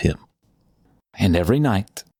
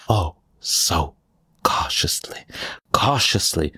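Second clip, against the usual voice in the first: hushed cautiously. A narrator's reading. Narrator dwelling on caution.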